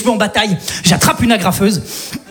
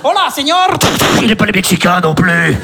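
lower, grave voiceover for a lone cop; movie trailer voice; 4 semitones lower than usual. Acted. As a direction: trailer voice laying down the law. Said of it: "comme si je suis dans un film de flic".

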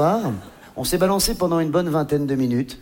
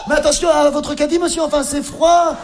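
mock indignant warning; 8 semitones higher, 4 dB louder than usual. Naked shopper warns a man.

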